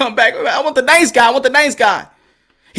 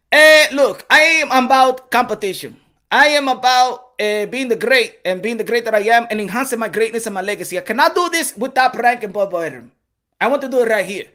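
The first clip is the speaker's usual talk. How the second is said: put-on accented plea that sinks lower and softer; Manny Pacquiao impression; 3 semitones lower, 3 dB quieter than usual. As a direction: make an earnest case in an imitated accent. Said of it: "Manny Pacquiao was, went to the office".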